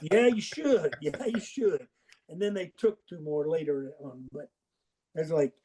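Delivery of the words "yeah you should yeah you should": higher, knowing "yeah, you should"; mild told-you-so; smug retort. Retold or imitated. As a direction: rub it in gently. Coach's own smug retort.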